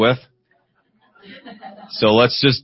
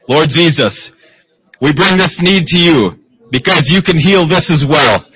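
raised, fervent prayer voice; preaching prayer; 8 semitones higher, 5 dB louder than usual. Real, not acted. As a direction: pray it out with rising fervor.

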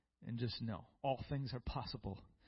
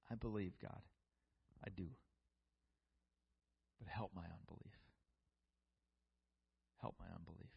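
softer, lower plea that fades away; quiet pleading prayer; 7 semitones lower, 10 dB quieter than usual. Acted. Preacher voicing the father's plea for the church, softening to near silence. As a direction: let the plea sink lower and softer each time.

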